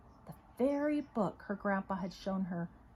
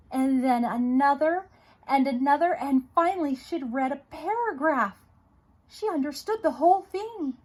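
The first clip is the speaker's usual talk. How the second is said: much louder and higher, building joy; triumphant reading; 5 semitones higher, 9 dB louder than usual. A narrator's reading. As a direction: let the breakthrough burst out. Climax as Tricia finally reads.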